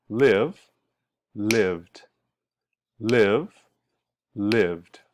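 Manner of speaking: "live" rising, "lived" falling with final "d"; "lived" in one syllable, voiced "d" tacked on; one-syllable D ending. Shown as a demonstration. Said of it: "one syllable".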